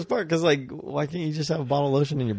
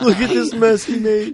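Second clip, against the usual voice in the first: put-on tattling kid; 9 semitones higher, 3 dB louder, slower than usual. Mock tattling continued.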